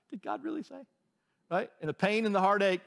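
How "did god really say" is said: much higher, softer mocking "did God really say?"; mock doubt. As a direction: whine the doubt in a high put-on voice. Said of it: "It's like".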